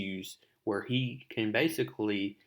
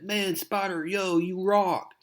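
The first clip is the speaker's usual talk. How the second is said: excited fan; 9 semitones higher than usual. Retold or imitated. Taxi driver praising Spider-Man, high and starstruck. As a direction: gush like a fan meeting his hero.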